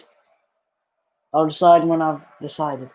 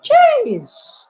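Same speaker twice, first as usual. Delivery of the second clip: surprised; 3 dB louder than usual. Real, not acted. Exclamation at a foul.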